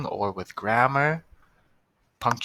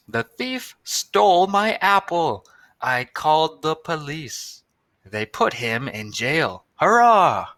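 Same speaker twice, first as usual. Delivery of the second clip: higher, louder storybook read; reading aloud to kids; 5 semitones higher, 6 dB louder than usual. Shown as a demonstration. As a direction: read it out to the class with energy.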